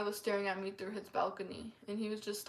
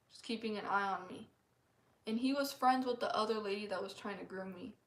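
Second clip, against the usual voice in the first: ordinary talk, nothing marked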